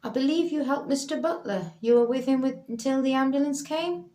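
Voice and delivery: a lightly voiced formal police constable; police officer. Officer questions Leela.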